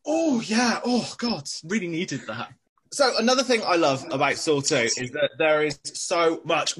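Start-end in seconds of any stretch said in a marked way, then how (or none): 0.0-2.5 exaggerated gulps of air, starting high; mock relief between huge breaths; obnoxiously big breaths. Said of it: "taking obnoxiously big breaths".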